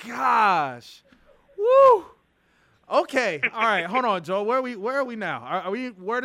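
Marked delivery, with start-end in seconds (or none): 1.6-2.0 excited whoop. Real, not acted. Host recovering from laughing fit.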